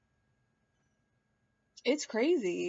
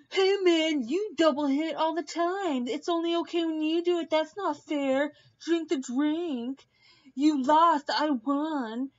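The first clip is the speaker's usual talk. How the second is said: higher, whiny imitation of an upset guy; mock Josh; 6 semitones higher than usual. Narrator imitating Josh arguing over the game, higher mock whine. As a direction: play him as a petulant loser. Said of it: "And then Josh was like".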